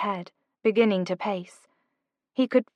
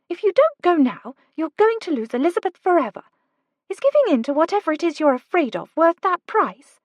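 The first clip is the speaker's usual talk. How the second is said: put-on lightly higher, urgent young woman; Kate's voice; 9 semitones higher, 4 dB louder, faster than usual. A narrator's reading. Kate urging Oliver to go, lightly higher voice. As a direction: push him, brisk and bright.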